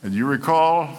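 ordinary talk

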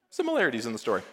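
usual speaking voice

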